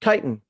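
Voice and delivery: chitin said KITE-in; long i after the K in chitin; K pronunciation. Shown as a demonstration. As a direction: say chitin with a hard K. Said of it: "See the K there".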